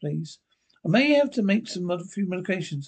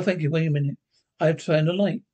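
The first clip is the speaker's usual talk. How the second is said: ordinary talk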